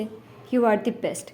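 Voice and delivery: ordinary delivery, nothing marked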